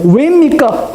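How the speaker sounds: high-pitched anguished plea to God; retold crying out. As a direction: cry it out high, as a protesting parent. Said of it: "하고 부르짖었답니다". (높은 소리로 부르짖듯)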